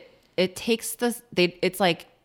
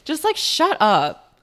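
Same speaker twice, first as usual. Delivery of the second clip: slow, drawn-out, high exasperated shut up; 8 semitones higher, 3 dB louder, slower than usual. Real, not acted. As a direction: stretch it out, fed up. Exasperated reaction.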